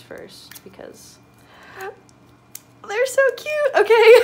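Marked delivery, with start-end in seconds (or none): 2.9-4.2 very high, loud squeal into laughter; excited squeal. Real, not acted. Fan sees cute photo cards, squealing with excitement.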